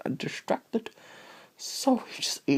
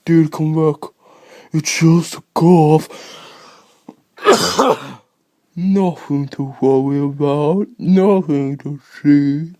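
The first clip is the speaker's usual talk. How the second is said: loud, slow mock reassurance; lightly voiced pleading friend; mock reassuring; 9 dB louder, slower than usual. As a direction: call the friend back, slowly and loud. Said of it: "and you're like".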